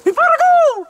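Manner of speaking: piercing high yelled call; leprechaun call. Acted. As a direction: screech it out like a leprechaun. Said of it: "I'm gonna do the leprechaun call".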